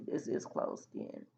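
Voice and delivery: whispered aside. Real, not acted. Muttered aside while redrawing letter.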